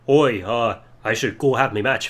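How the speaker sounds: low mock Irish accent; a hint of deep Irish brogue; Sheamus Irish accent. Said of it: "And Sheamus is like".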